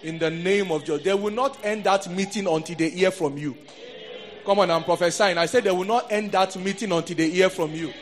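loud, emphatic prophetic declaration; forceful pulpit proclamation; fervent preaching. Real, not acted. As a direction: declare it over the congregation with force.

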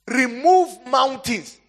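"Remove mountains," drawn out slowly, high and loud; slow emphasis. Acted. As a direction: stretch every syllable of "Remove mountains,". Preacher quotes scripture emphatically.

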